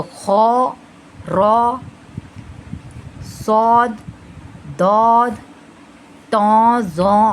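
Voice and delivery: "खौ, रॉ, सौद" one by one, rounded; separate, held heavy letters from "खौ" to "जौ". Shown as a demonstration. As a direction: sound "दौद, तौ, जौ" full-mouthed. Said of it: "तो ये heavy letters हो गए".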